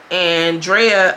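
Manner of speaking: usual speaking voice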